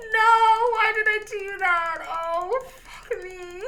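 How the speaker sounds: high, loud lament; wailing regret. Real, not acted. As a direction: cry out in despair, fading at the end. Laments the accidental mistake.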